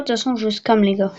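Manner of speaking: usual speaking voice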